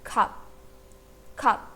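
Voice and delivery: "khap" short, ending only on a P; pronunciation demo. Shown as a demonstration. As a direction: model "khap" as one syllable with a closed P. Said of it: "It will be pronounced as". ("khap" का उच्चारण खप, अंत में सिर्फ़ प)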